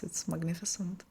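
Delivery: a soft, awed whisper. Real, not acted. Mentor whispering praise of the guest's results. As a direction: breathe it out in admiration.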